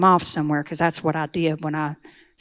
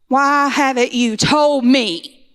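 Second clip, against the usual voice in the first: slow, raised, higher cry of betrayal; retold anguished scream, louder and higher; screaming at God; 6 semitones higher, 4 dB louder, much slower than usual. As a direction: plead and accuse God at once, dragging each word. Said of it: "I began screaming at God".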